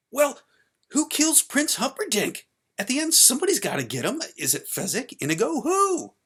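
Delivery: high, loud, desperate demand; calling out. Acted. Child calling to dad in the hall, desperate. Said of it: "when I called out".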